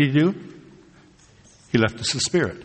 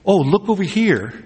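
lightly acted tempting voice, pitched up; luring false voice; 7 semitones higher than usual. A misleading voice draws the believer aside, mock luring. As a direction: pitch it up like someone calling attention away. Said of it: "when you hear a voice saying".

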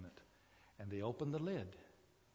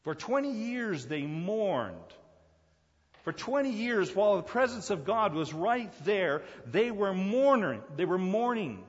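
fervent preaching; 9 semitones higher, 10 dB louder than usual. Real, not acted. Preacher tells how all the people mourned, fervent preaching. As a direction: stretch the key words fervently.

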